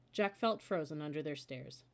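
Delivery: usual speaking voice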